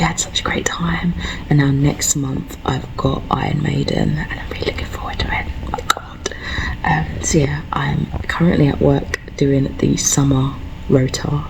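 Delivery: hushed whisper so coworkers won't hear. Real, not acted. At lunch at work, telling viewers in a whisper. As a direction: keep it secret, breathe the words.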